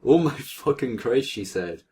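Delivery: ordinary talk, nothing marked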